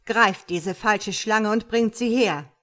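slow, loud order; emphatic, angry command. Acted. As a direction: order the arrest forcefully. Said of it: "befiehlt sie".

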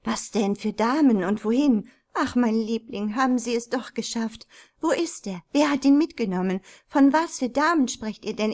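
put-on firing questions at the children in alarm; calling out. A narrator's reading. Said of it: "rief sie". (aufgeregt rufen, lauter und höher)

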